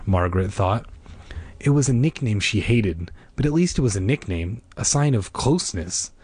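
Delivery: ordinary delivery, nothing marked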